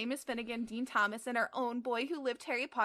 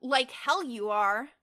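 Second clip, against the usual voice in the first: defiant; 3 semitones higher, 8 dB louder, slower than usual. Acted. Teddy lunging for paper.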